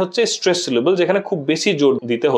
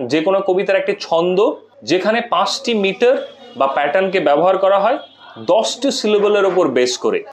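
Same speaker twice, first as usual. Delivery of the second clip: normal talking voice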